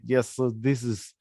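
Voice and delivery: put-on slow, halting Russian accent. Recording funny disc golf commentary as a foreigner, Russian accent. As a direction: play a Russian commentator unsure of American terms. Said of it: "in a Russian accent".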